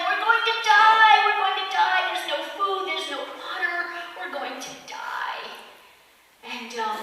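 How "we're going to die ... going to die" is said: high, loud panicked cry, fading at the end; mock panic from a shipwrecked man; panicked yelling. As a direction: scream that they're all going to die. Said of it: "starts screaming, yelling".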